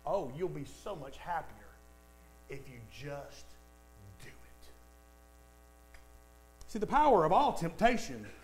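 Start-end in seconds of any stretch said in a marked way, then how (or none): 0.1-4.7 much lower and softer, fading away; tempting hush; seductive murmur. Retold or imitated. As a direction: coax it like a sweet lie. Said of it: "the age-old lie".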